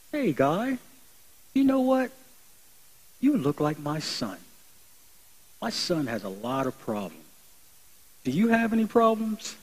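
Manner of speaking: a mother gently reaching out to a stranger; kind stranger. Retold or imitated. Stranger woman consoles crying man.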